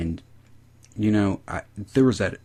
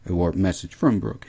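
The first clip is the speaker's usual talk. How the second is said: ordinary delivery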